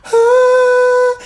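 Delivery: very high light airy falsetto ah. Shown as a demonstration. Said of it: "Falsetto is a light and airy voice".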